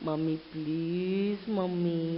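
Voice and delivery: slow, soft, drawn-out plaintive begging; very sad voice. Acted. Chick calls the mother who mocked him, sad pleading. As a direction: draw out each plea sorrowfully. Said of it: "With very sad voice".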